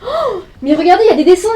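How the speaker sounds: high, loud shocked cry; startled discovery. Acted. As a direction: gasp it out as you spot them. Finds drawings on the walls.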